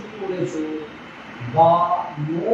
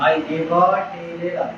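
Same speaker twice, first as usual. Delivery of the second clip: slow dictation; 3 semitones lower, much slower than usual. Shown as a demonstration. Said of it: "কি হবে বাংলা ইংরেজি?".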